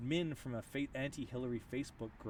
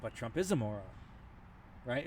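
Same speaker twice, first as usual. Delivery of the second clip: usual speaking voice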